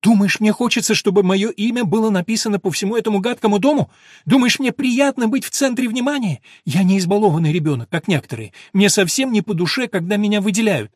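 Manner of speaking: high, loud, hurt outburst; almost yell it, offended; nearly shouting. A narrator's reading. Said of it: "едва не сорвалась на крик".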